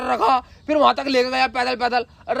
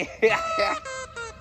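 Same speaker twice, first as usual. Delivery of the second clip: high, soft gloating chuckle; sly laugh; 12 semitones higher, 8 dB quieter than usual. Acted. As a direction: laugh quietly, high-pitched, pleased with your cunning. Crowing over own cleverness.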